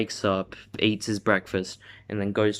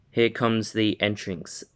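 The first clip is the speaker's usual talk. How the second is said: usual speaking voice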